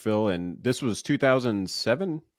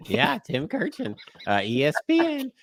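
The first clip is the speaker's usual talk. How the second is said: Tim Kurkjian voice; 11 semitones higher than usual. Retold or imitated. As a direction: lift the pitch and stretch the name like an excited TV reporter. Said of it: "but Tim Kurkjian".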